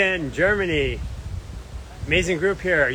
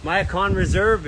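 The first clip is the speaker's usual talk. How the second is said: ordinary delivery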